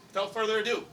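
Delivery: announcer introduction. Acted. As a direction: belt the name out like a boxing announcer. Introducing the next mayor.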